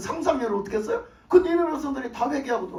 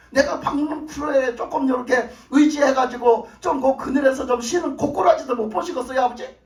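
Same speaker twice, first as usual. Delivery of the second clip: Jonah shouting at God; 2 semitones higher, 3 dB louder than usual. Acted. Jonah complains to God about the gourd, shouting. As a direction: fling the grievance at God. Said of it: "이렇게 하는 거야, 요나가".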